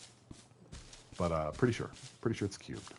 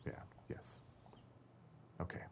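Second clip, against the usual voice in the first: whisper; 23 dB quieter than usual. Real, not acted. Whispered aside.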